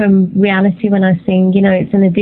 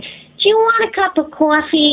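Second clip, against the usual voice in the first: higher-pitched imitation New York accent; 8 semitones higher than usual. Shown as a demonstration. British singer doing an American impression for interviewer, New York accent. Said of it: "you're going a little New York there".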